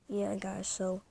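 normal talking voice

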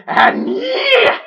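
very high-pitched elated squeal; real excitement. Real, not acted. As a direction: squeal it out, overjoyed. Elated reaction to thumbnail.

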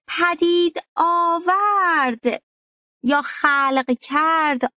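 پدید آورد said very slowly and high, each word separate; slow dictation. Shown as a demonstration. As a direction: dictate the meanings slowly for notes. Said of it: "معانی دیگه ای رو هم براش آوردم".

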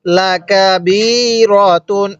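ওয়াসসালাতি drawn out slowly and articulated clearly; careful recitation. Shown as a demonstration. Teacher teaching the word, slow careful recitation. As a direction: model ওয়াসসালাতি slowly for the class. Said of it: "এরকম ভাবে পড়ব".

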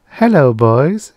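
coy, high, drawn-out greeting; slow, swooping come-on; simpering. A narrator's reading. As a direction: simper it loudly and flirtatiously. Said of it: "she simpered".

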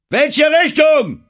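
high, slow full-voiced shout; calling out. A narrator's reading. Driscoll shouts up to the lookout for direction, loud call. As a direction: holler the question, stretching it out. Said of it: "rief Driscoll".

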